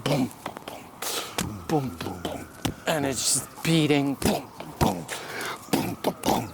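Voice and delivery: low fading boom boom of a heartbeat. Retold or imitated. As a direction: thump it like a heartbeat. Heartbeat imitation.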